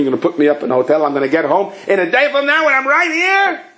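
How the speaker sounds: high-pitched, incredulous outburst; indignant protest. Acted. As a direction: rush in, then climb into a high indignant question. Acted protest about a travel delay.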